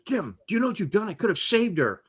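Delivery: urgent, quick reproach; McCoy. Acted. Doctor reproaching Jim over Edith Keeler, agitated. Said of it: "McCoy goes".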